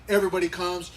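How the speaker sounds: ordinary talk, nothing marked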